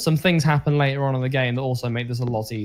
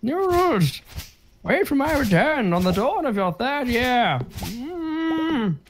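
put-on old man voice; 10 semitones higher, much slower than usual. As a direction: read it as a creaky old grandfather. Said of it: "I'll do the old man voice".

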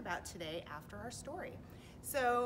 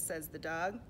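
plain reading voice

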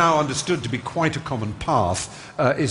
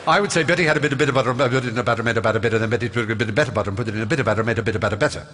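rattled off in a rush; boy rushing through the tongue twister too fast; gabbling too fast; much faster than usual. Retold or imitated. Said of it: "Slow down, dear".

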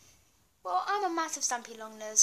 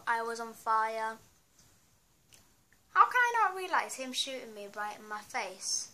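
ordinary talk, nothing marked

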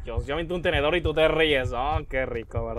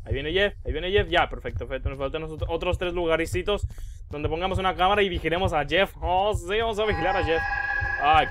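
usual speaking voice

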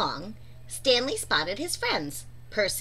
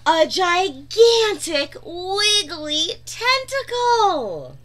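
slow, higher, gasping reveal; awed suspense; 6 semitones higher, 5 dB louder, slower than usual. A narrator's reading. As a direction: stretch it out in breathless awe. Narrator builds suspense, gasp.

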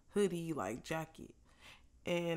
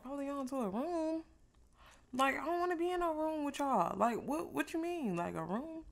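incredulous; 7 semitones higher than usual. Retold or imitated. Retold refusal to airport staff.

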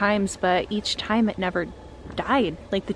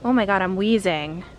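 normal talking voice

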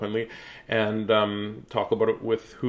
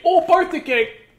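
surprised delight; 8 dB louder than usual. Real, not acted. Excited aside about birthday.

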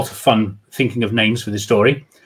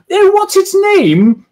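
high, loud and slow falsetto gush; man doing a high society woman; posh lady voice; 5 dB louder, slower than usual. Acted. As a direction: coo over the dog's name. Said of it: "doing women's voices".

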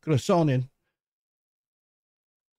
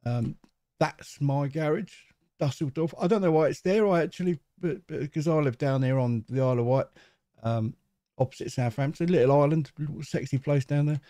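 ordinary delivery

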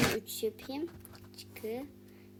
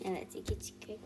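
whisper; 2 semitones lower, 5 dB quieter than usual. Real, not acted. Quiet comment during play.

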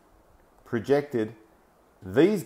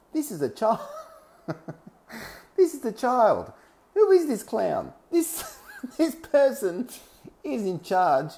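mocking laughter; 12 semitones higher than usual. Real, not acted. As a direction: laugh at him as you say it. Mocking a young politician, laughing.

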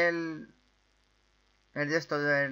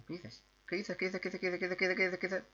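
quick, soft machine-gun patter; rapid-fire repeating; 3 semitones higher, 10 dB quieter than usual. Real, not acted. Gamer startled by what appears, rapid soft patter. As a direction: rattle it off under your voice without a gap.

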